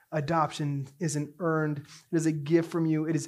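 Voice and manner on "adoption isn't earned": ordinary talk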